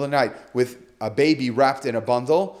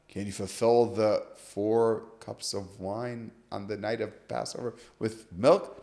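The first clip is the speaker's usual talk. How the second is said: slow, low, quiet repetition of the question; deliberate restating; 5 semitones lower, 7 dB quieter than usual. Retold or imitated. Rabbi walking students through the question, deliberate restating. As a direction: spell it out slowly in a lowered voice.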